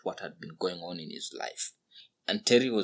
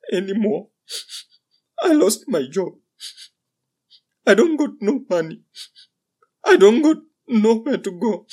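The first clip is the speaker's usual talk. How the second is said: very high, loud sobbing lament; 14 semitones higher, 15 dB louder than usual. A narrator's reading. Drunk laborer breaking down to the old man, sobbing. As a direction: wail it out through tears. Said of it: "began to sob".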